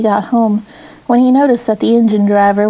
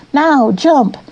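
a raised, higher call of command; 5 semitones higher than usual. A narrator's reading. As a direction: sing out the order to jump. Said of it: "he heard the driver call out".

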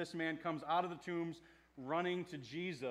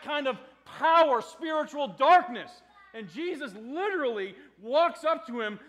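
loud, climactic preaching, higher and louder; full-force preaching; 9 semitones higher, 12 dB louder than usual. Acted. Preacher proclaiming Jesus' power over darkness, loud and full force. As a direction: drive the climax home.

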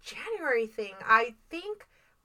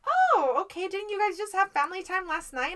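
quoting friend; 6 semitones higher than usual. Retold or imitated. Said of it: "And she was like".

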